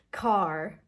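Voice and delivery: "car" drawn out on a strong R; strong American R. Shown as a demonstration. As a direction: finish "car" on a curled tongue. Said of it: "it's a strong ra sound".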